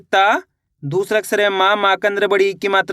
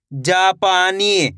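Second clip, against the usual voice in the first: जापानी slowly, with long vowels; each syllable of जापानी held out evenly; drawn-out reading; much slower than usual. Shown as a demonstration. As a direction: stretch जा, पा and नी in जापानी. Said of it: "खींच के पढ़ा जाएगा".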